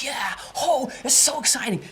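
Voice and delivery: high, loud burst of excitement; mock thrilled shout; excited yelp. Shown as a demonstration. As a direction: gush like an overexcited fan. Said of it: "we go like".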